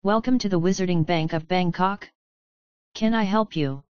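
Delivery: every sentence ends on a rising tune; question-like endings. A narrator's reading. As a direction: lift each ending like a question. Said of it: "ending each sentence as though it were a question".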